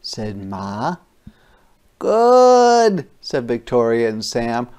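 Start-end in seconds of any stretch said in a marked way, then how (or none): 2.0-3.1 put-on child's voice. Kids answering Ma about cookies.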